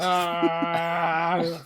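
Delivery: long, loud battle yell; charging scream. Acted. Player as Krill charging at the enemy, long scream. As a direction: hold a loud, sustained "ah" as you charge. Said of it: "just charges, screaming. Not like".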